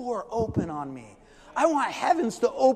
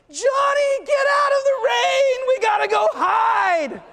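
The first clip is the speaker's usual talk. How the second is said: put-on high, loud panicked yelling at a child; frantic shout; 9 dB louder, slower than usual. Worried parents shouting to Johnny, loud and high. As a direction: yell for the kid to come in from the rain. People calling kid out of rain.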